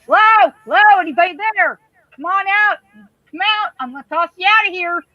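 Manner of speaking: high, challenging call to an intruder; mock-tough holler to whoever's hiding. Acting out calling to intruder.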